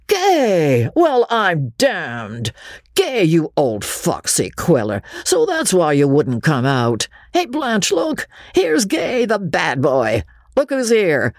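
high, boisterous calls of surprise; hailing a friend. Acted. Party guests catch sight of Ravenel, hailing him boisterously. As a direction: hail an old pal across the room.

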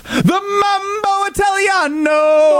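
a slow, high, shouted hammed-up Italian accent; mock Italian accent. Host announcing his favourite pizza in a hammed-up Italian accent. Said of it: "Look at me with my accent".

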